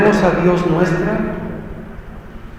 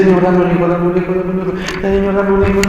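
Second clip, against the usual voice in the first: mock begging; 4 semitones higher, faster than usual. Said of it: "No, no".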